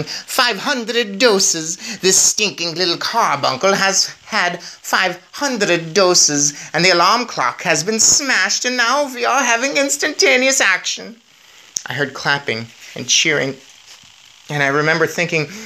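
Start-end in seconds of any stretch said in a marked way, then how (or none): 0.0-11.2 high, slow, triumphant witch cry; Grand High Witch yelling her triumph, high and drawn out. Acted. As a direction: crow it out like a gloating witch. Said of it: "the voice of the Grand High Witch yelling".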